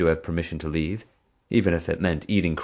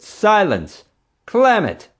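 much higher, louder shout; 8 dB louder, slower than usual. Acted. Park boss silencing the quarrelling pair with a shout. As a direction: cut everyone off at full voice. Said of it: "she shouted".

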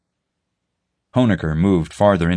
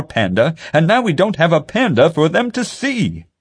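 shouting; 11 semitones higher, 4 dB louder than usual. A narrator's reading. Pete keeps berating Marge.

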